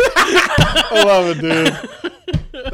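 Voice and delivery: drawn-out laughing words. Real, not acted. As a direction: laugh through it slowly. Laughing, hammed.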